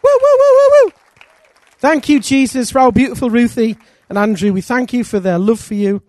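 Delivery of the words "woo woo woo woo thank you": ordinary talk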